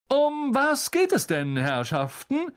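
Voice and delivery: high, grand opening falling to a rising question; self-important star making an entrance; jovial pompous celebrity. Acted. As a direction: boom in grandly on the crowd.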